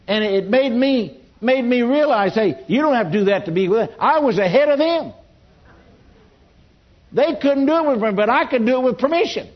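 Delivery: preacher's excited climax. Real, not acted. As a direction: build to a proud, fervent peak. Proud climax of the story.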